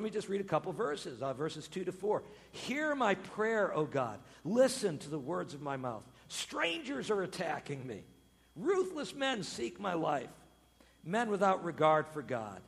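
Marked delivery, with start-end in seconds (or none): 2.5-12.6 solemn psalm reading. Acted.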